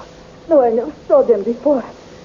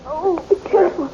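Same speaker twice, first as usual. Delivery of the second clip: higher, urgent, half-breathless warning; anxious plea, pitched higher; fearful plea; 6 semitones higher, 4 dB quieter than usual. Acted. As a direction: beg him to be careful, frightened. Princess fears for Flash.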